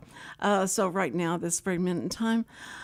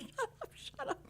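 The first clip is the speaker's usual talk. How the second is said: frantic hushing; 13 dB quieter than usual. Retold or imitated. Embarrassed grandson hushing her.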